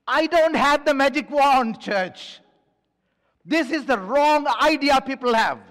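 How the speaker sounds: loud, high, emphatic sermon voice; impassioned pulpit delivery; full-force preaching. Real, not acted.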